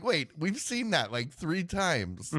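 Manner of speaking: high, incredulous mock complaint; exasperated disbelief. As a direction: act baffled by the rerun. Exasperated retelling of repeated scene.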